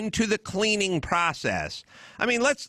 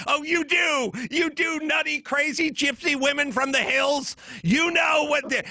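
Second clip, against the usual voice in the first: mock outraged shout; 7 semitones higher than usual. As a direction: yell it at them like they're witches.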